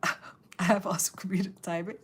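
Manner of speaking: a joking whisper. Real, not acted. Streamer whispers a joke to chat about her timing. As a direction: murmur the self-praise as a secret.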